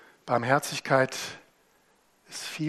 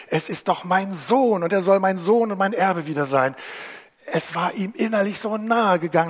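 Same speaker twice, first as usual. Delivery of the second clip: a hint of an eager father, higher and louder; the father speaking; 3 semitones higher, 7 dB louder than usual. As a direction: voice the father's urgent love, lightly. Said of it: "der Vater sagt".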